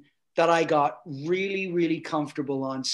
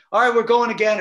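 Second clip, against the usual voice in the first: raised, louder announcement; Peter; 6 semitones higher, 8 dB louder, faster than usual. Retold or imitated. Director announcing another take to the crew, called out. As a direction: call it out to a whole crew. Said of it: "Peter says to the crew".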